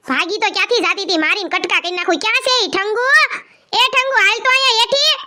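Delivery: high-pitched angry yelling, threatening; furious shouting. Acted. An enraged elder ordering Thangu brought downstairs, shouting. Elder raging about runaway girl.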